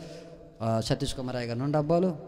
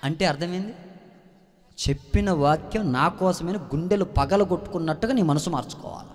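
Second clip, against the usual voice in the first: normal talking voice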